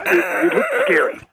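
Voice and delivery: high, crying alien wail. Pretend. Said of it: "That's my alien impression".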